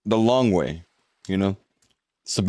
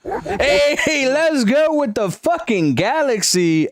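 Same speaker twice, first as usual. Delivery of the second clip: much higher, stretched-out happy cry of thanks; excited; 13 semitones higher, much slower than usual. Real, not acted. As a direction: blast it out, thrilled by the gift. Thanks viewer for gift.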